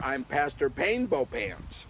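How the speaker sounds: "Painbow" said slowly with a P; playful rename. Shown as a demonstration. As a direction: stress the P in "Painbow". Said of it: "you see that? ... It's a P".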